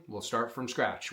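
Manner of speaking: ordinary talk, nothing marked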